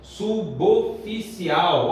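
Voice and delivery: "Suboficial" slow, as su-bo-ficial; correct pronunciation. Shown as a demonstration. Teacher repeats the correct form, "Suboficial" as slow su-bo-ficial. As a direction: link b to o in "Suboficial". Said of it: "a pronúncia correta é subo".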